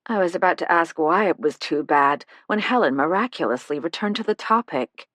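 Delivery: usual speaking voice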